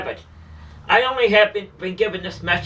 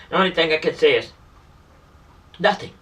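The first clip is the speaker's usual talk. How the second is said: ordinary delivery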